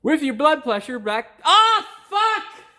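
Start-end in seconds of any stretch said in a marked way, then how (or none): 1.4-2.7 real outburst, much higher and louder; frustrated yell. Real, not acted. Mechanic botching his line on camera, real frustrated yell. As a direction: snap out the curse at the top of the voice.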